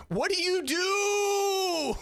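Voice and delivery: high, slow, hammed-up crying shout; mock anguished wail. Reacting to the cringe, mock crying wail. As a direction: wail it like fake despair.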